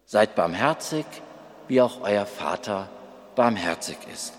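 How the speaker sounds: hushed careful dictation of a title; quiet dictation. Acted. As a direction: say it softly while writing it down. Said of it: "Dann nehme ich das als Überschrift".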